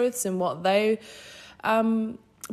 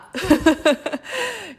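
high amused laugh; 10 semitones higher, 5 dB louder than usual. Real, not acted. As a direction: laugh at yourself. Laughs after whatever.

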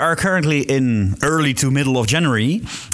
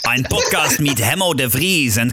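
mock German intro; 2 semitones higher than usual. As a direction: announce the show in German.

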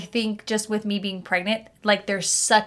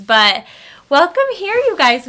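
much higher, louder greeting; loud excited welcome; 7 semitones higher, 8 dB louder than usual. Real, not acted. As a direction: belt the welcome out with energy. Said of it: "Very weak".

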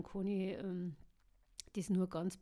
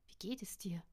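soft unvoiced whisper; whispered question; 6 dB quieter than usual. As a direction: whisper so no one hears. Hiding in captors' cave.

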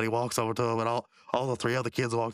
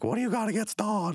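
raised put-on challenging voice; Mordecai; 10 semitones higher than usual. Friend questioning a grudge against a brother, high mock challenge. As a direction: question a friend in a pitched-up cartoon voice. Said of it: "then Mordecai's like".

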